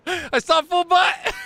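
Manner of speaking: high, slow, shocked shout; excited shout. Real, not acted. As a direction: yell it out in shocked glee. Shocked at the reversed scene.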